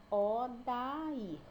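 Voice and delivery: "Odair" drawn out as O, da, ir; one syllable at a time. Shown as a demonstration. Teacher showing three syllables, "Odair" one by one. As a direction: give "Odair" three distinct beats. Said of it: "eu falo cada sílaba".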